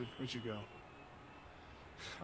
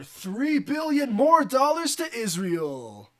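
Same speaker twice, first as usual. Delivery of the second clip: slow, loud, higher proclaiming; booming oration; 9 semitones higher, 10 dB louder, slower than usual. Pretend. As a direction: bellow it like a rally speech. Speech climax, slow loud.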